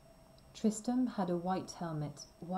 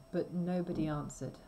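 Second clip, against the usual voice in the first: usual speaking voice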